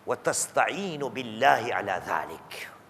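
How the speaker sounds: low, soft, breathy recitation; hushed sacred quote; whisper. Acted. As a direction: whisper the Arabic as a secret. Preacher quotes Arabic text.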